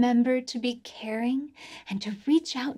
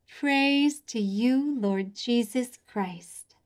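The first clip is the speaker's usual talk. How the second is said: slow, drawn-out response; slow repeated response; much slower than usual. Shown as a demonstration. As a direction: say it slowly together with them. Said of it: "Your turn".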